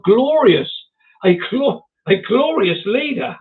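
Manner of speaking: hammed-up self-important relish; mock grandeur. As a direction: savour "Glorious" loud and lofty. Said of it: "I'm feeling quite important now".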